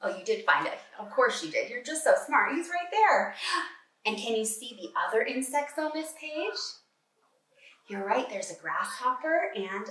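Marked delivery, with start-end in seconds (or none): none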